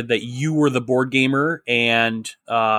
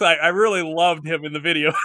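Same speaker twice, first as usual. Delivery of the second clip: laughing; 3 semitones higher than usual. Real, not acted. Joking about the videos, breaks into laughter.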